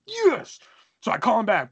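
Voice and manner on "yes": high-pitched triumphant cheer; re-enacted burst of victory. Teen just handed forty bucks for SummerSlam, triumphant.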